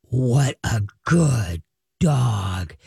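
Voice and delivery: deep, drawn-out, ominous dog talk; low, slow tones. Shown as a demonstration. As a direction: praise growled low and very slowly. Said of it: "Low, slow tones, you're ticked".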